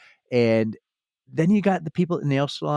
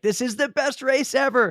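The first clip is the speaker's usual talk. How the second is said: put-on higher-pitched gushing quote in her own accent; excited quote; 9 semitones higher than usual. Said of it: "I can't do a British accent".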